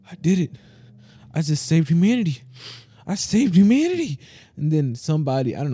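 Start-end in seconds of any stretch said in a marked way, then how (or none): 0.1-4.2 mock triumphant cry, climbing higher; triumphant hero. Storyteller acting the man who saved humanity, triumphant. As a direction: build from a gasped realisation to high elation.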